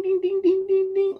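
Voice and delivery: very high squeaky ding, ding imitation; phone dings. Phone alerts mimicked in a very high squeak.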